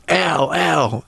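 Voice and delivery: mock coach's call, pitched well up; imitated coach's yell. Coach calling to the referee, mock yell. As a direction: call out high without real volume. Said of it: "And the coach yells".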